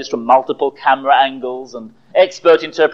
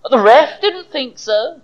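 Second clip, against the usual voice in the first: slow, slightly higher, smug; trace of smugness; 9 semitones higher, slower than usual. Acted. Rival fan replying to his angry friend, faintly smug. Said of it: "with just a trace of smugness in his voice".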